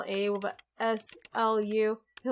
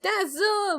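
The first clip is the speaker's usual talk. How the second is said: excited shout; 9 semitones higher than usual. Acted. Spots the dinosaur again.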